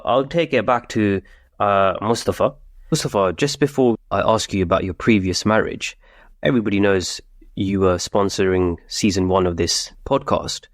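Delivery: ordinary delivery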